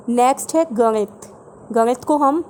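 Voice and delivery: ordinary talk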